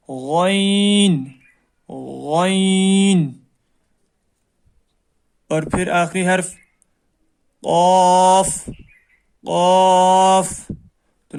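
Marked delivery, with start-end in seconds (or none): 0.1-3.3 غین drawn out slowly with a full heavy back sound; heavy letter. Shown as a demonstration. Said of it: "موٹی آواز میں پڑھا جائے گا". 7.6-10.5 قاف slow, full and heavy from the throat; heavy letter. Shown as a demonstration. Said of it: "موٹی آواز میں پڑھا جائے گا".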